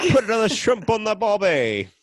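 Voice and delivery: high, loud, drawn-out mock Australian accent. Friend answering teasing about his accent, hammed-up Australian. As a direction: ham up a stock Aussie catchphrase, slowly. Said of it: "more English than your Australian accent".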